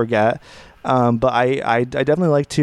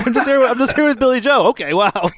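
higher voice breaking into laughs; giddy inner monologue; 8 semitones higher than usual. Retold or imitated. Voicing his friend's thoughts beside [name], giddy and laughing.

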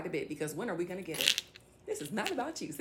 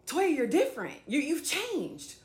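higher-pitched imitation of critics, drawn out at the end; mock accusation; 7 semitones higher than usual. Critics telling her she has changed, mock accusation. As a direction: imitate their disapproval, pitched up. Acts out others' accusing remark.

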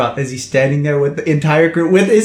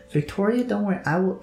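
reassuring; 7 semitones higher, 5 dB quieter than usual. Acted. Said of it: "he looks at Victoria and he's like".

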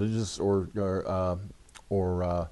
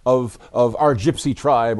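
louder, slow solemn announcing; storyteller voice; 3 semitones higher, 9 dB louder than usual. Retold or imitated. Author's character voice quoted.

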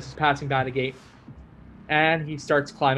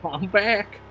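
higher, different put-on voice; odd switched voice; 6 semitones higher than usual. [name] announcing his return, higher and in a strange voice. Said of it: "Don't know why I switched that voice".

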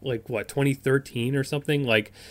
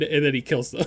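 normal talking voice

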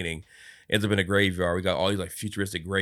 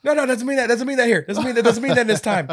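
high, loud, laughing retreat; frantic backpedal; 8 dB louder, faster than usual. Retold or imitated. Scrooge backing down from the driver, high and flustered. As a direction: wave it off fast.

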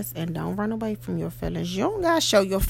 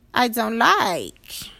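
louder and slow, leaning on I; emphatic complaint; 4 semitones higher, 7 dB louder, much slower than usual. Pretend. Telling herself what she dislikes, slow and firm. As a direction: stretch it out firmly. Voicing self-talk, repeated.

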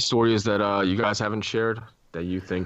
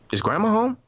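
high-pitched retold question, louder at the end; imitating girlfriend's question; 13 semitones higher, 4 dB louder than usual. Girlfriend at the table asks about grandma, high imitated voice. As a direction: lightly mimic a girlfriend asking, voice lifted high. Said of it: "she was like".

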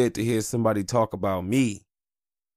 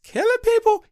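very high falsetto question, louder; high cartoon falsetto, disbelieving; squeaky doubting mutant; 8 dB louder than usual. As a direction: squeak it out like a nervous sidekick. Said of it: "like Professor X would describe Wolverine".

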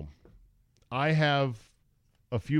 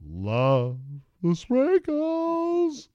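drawn-out high sung sprinkles, slow and louder; swooning over sprinkles; 11 semitones higher, 4 dB louder, much slower than usual. Acted. Host swooning over donut sprinkles, high and slow. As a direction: croon the sprinkles like a love song.

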